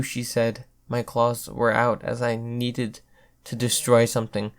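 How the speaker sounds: plain reading voice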